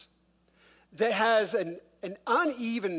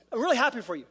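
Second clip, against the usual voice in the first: higher, overdone congratulations; passive-aggressive; 4 semitones higher, 4 dB louder, faster than usual. Acted. Grumbler covering a muttered insult, passive-aggressive. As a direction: cover the resentment with loud fake cheer. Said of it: "filled with passive aggressiveness".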